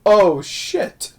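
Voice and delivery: imitating Mrs Doubtfire's dismay. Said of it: "he's like".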